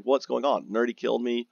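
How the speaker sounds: usual speaking voice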